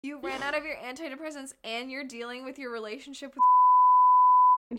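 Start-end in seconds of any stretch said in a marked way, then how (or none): 3.3-4.8 censor bleep at the end; last words hidden by a bleep. Real, not acted.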